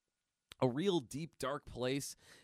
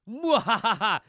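loud, high evil cackle; sneering panto villain; 7 semitones higher, 13 dB louder, slower than usual. Acted. Villain laughs after taunt.

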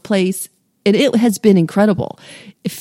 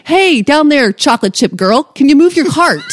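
calling out; 6 semitones higher, 3 dB louder than usual. Retold or imitated. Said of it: "And I'm like".

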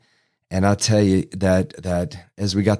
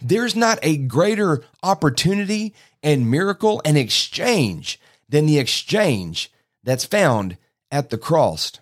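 high-pitched, emphatic sermon declaration; preacher driving the main point home; emphatic preaching; 7 semitones higher than usual. Real, not acted. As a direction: proclaim it to the congregation, phrase by phrase.

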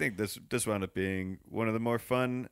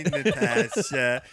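menacing; 4 semitones higher, 6 dB louder, much slower than usual. Retold or imitated. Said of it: "I'm gonna find you".

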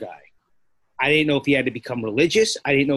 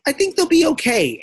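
airy nurse voice pitched much higher, lightly imitated; mock nurse reply; 4 dB louder than usual. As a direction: squeak it out like a dismissive nurse, half-mocking. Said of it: "and they're like".

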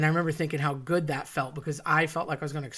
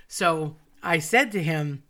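ordinary talk, nothing marked

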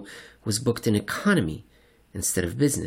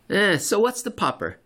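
Silverbug voice; 10 semitones higher than usual. Acted.

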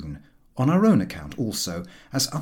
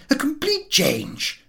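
a hint of Harris, slow and higher; lightly voiced Harris; slower than usual. A narrator's reading. Harris to his friends, a touch higher and slow. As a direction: Harris stretching out the words slowly. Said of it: "he said".

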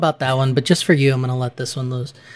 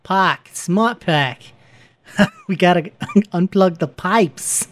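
higher, badly faked Boston accent; 4 semitones higher, slower than usual. Cracking up during the Boston plumber riff, faked Boston accent. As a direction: giggly attempt at Boston plumber talk. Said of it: "This is terrible".

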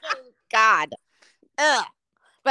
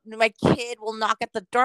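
usual speaking voice

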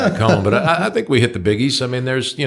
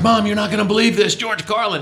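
breathless news to mom, pitched much higher; retold excited call; 9 semitones higher than usual. Comic telling his mom about Carlin, excitedly higher. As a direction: blurt it out like you can't believe it. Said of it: "I was like".

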